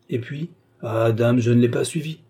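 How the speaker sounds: ordinary delivery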